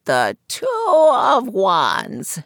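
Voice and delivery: put-on high croaky old seer's voice; croaking fortune teller. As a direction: croak it from behind a curtain. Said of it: "she explained".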